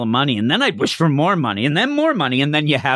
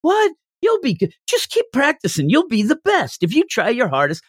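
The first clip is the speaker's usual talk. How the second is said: encouraging parent; 11 semitones higher than usual. Retold or imitated. Parent cheers on the child who doubts making the baseball team. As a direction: pump the kid up like an eager parent.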